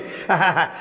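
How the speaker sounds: soft low chuckle; brief chuckle under the breath; short laugh. Real, not acted. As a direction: let a quiet laugh slip out. Brief laugh mid-conversation.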